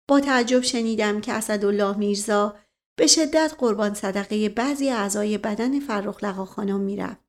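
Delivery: plain reading voice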